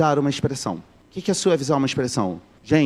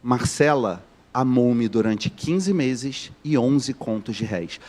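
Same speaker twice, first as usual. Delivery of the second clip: measured literary repetition; slow repeated reading; 3 semitones lower, much slower than usual. Shown as a demonstration. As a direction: repeat the quote slowly so it sinks in. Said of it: "Vou repetir".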